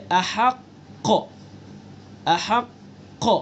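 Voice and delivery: ahaqq drawn out slowly, heavy bounce on the qaf; strongest qalqalah on qaf. Shown as a demonstration. Teacher giving an example of doubled-letter stop, strong echoing qaf in ahaqq. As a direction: hold the doubled qaf, then release it hard. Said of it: "سب سے زیادہ قلقلہ ہوگا".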